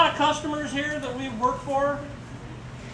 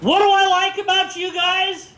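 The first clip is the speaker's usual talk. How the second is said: yelled call; 9 semitones higher than usual. Shown as a demonstration. Speaker calling out to Cub Scouts at pack meeting, yelling. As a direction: holler it out on a high, held pitch. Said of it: "I'm gonna yell out".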